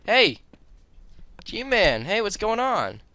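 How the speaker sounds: loud high call; shouting. Real, not acted. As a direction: call out across the room. Calling out to a character.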